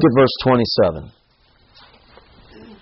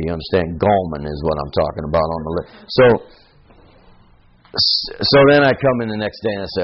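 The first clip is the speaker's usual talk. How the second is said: ordinary talk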